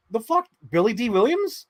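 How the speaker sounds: high, shocked disbelief; high incredulous question. Retold or imitated. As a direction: brother shocked at his sister, high disbelief. Incredulous reply to sister.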